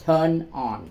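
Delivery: slow, deliberate English phrase; slow it down so learners catch it; very slow demonstration read; slow model phrase. Shown as a demonstration. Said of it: "Mở đồ điện á, là turn on".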